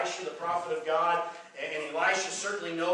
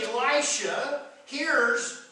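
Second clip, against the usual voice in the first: drawn out; 7 semitones higher, much slower than usual. Acted. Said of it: "preacher slows for drama".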